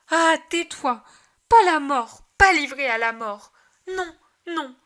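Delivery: mother crying out against the psalm; anguished outcry. Acted. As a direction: stop the words of death. Mary cuts off the psalm.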